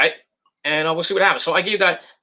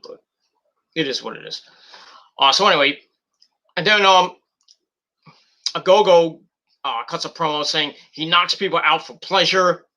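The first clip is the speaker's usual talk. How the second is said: ordinary talk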